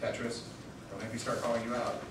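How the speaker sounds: normal talking voice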